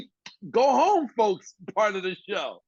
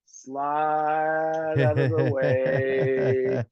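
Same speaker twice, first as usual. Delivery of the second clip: very slow, stretched vowels; drawn-out sneaking away; 2 semitones lower, much slower than usual. Retold or imitated. Comic edging off beside Dick Clark, drawn-out slow words. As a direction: stretch every word as you edge away.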